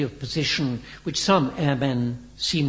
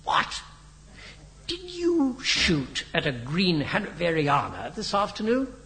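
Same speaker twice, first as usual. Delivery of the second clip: higher-pitched incredulous question; amazement; 5 semitones higher than usual. A narrator's reading. Biggles questions Henry about the shot-down plane, amazed. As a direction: draw out the disbelief. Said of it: "cried Biggles in amazement".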